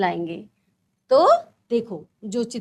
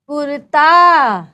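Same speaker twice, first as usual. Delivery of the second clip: drawn-out reading; 8 dB louder, much slower than usual. Shown as a demonstration. Teacher reading picture names slowly to young pupils. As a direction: stretch kurta out slowly and loudly, syllable by syllable. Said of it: "देखो, मैं पढ़ रही हूँ".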